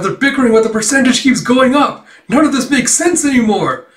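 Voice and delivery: high, loud mock-bewildered outburst; exasperated disbelief. Operator baffled by the rising percentage, high exclaiming. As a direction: imitate a baffled operator crying out.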